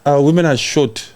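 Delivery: ordinary talk, nothing marked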